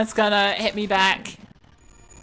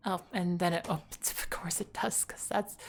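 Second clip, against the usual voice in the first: breathy whispered mutter; whispered aside; 4 semitones lower, 14 dB quieter than usual. Real, not acted. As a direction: whisper it to yourself.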